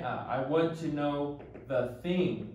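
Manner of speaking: I want to know the thing, drawn out slowly; slow teaching emphasis. Shown as a demonstration. Teacher contrasting thing with what for learners, slowly. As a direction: spell the phrase out slowly and deliberately. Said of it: "not what".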